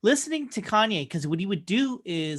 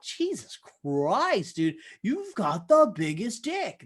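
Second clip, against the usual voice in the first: slow, drawn-out mock disbelief; mock exasperated; 2 semitones higher, slower than usual. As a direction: drag it out, unimpressed and teasing. Host's mock inner reply to [name].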